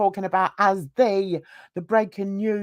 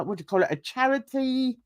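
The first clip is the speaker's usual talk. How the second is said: ordinary delivery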